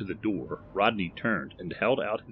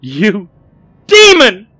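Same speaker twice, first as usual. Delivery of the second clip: high screamed accusation; horrified accusation; 14 semitones higher, 20 dB louder, slower than usual. A narrator's reading. Millie accuses Barry before fleeing, screaming. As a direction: scream it in horror.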